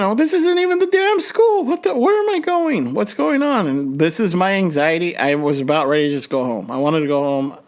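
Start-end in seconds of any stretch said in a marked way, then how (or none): 0.0-3.7 high, frantic panic; anxious grad at the wrong school, panicking. Retold or imitated. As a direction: blurt it out like everything's falling apart.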